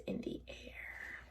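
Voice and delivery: hushed secret aside; whispered narration. A narrator's reading. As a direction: breathe it out.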